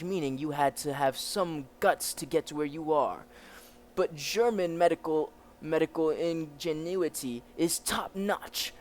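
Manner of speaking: commanding officer with a put-on German accent; German officer character. German officer explains the prisoner's state, acted officer voice.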